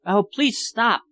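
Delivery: high, pleading cry of stop. A narrator's reading. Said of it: "Philippa cried".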